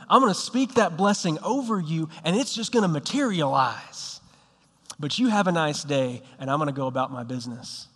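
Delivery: mock-pious Southern blessing, insincerely sweet; Southern "bless your heart" brush-off; Southern accent. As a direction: swing the pitch up in a put-on Southern accent, then settle into a brush-off. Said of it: "I'm gonna take on a southern accent here".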